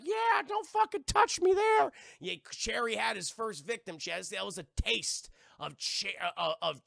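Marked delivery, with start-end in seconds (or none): 0.0-1.9 high, loud indignant chair; hammed-up high scolding yell; Cherry's voice. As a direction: shout it slowly in a raised cartoon voice. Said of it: "Cherry's like".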